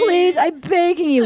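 high mock-tearful begging; hammed-up weepy plea; imitating sobbing pleas. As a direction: plead as if crying. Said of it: "And she's sobbing".

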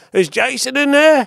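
very high, loud, drawn-out caricature; mock Australian rocker. Rock star calling at an office door, high mock Australian. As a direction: squeal it slowly in a put-on Aussie voice. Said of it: "I hear him going".

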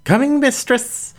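high, bright-eyed call from another room; eager servant Wren. Acted. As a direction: pipe up eagerly from behind. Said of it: "followed by Wren's voice".

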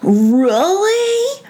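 very high squeaky mouse question; drawn-out rising disbelief in a tiny voice; amazed. Acted. Brisbane hears of a nice giant, amazed.

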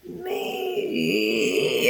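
low raspy croak with a cry in it; raspy character voice. Acted. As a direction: try out a rasping creature voice. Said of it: "A little bit higher".

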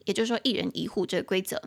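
ordinary talk, nothing marked

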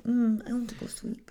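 put-on soft, high baby voice; sleepy baby talk. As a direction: whine it like a sleepy toddler. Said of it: "I'm like".

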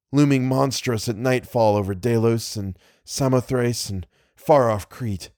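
usual speaking voice

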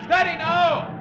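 high, loud yell over the wind; shouted orders. Acted. Skipper yelling to Halvard at the wheel, loud. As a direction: roar the order to hold course. Calling soundings in the storm.

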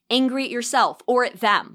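normal talking voice

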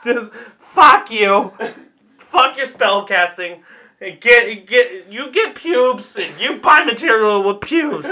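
loud, slowed mock outburst in American accent; American accent again. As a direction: drop the fake voice and rant in American English. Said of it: "I went back to American accent because I gave up".

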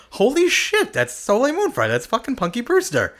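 very high, giddy starstruck gasp; squeaky high starstruck delight. Retold or imitated. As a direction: fangirl over the star. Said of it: "I was like".

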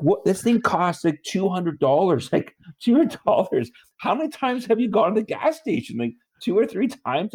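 a man ranting at his mother-in-law in disbelief; exasperated retort. Retold or imitated. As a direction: pitch it way up in outraged disbelief.

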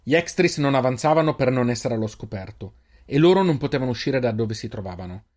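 plain reading voice

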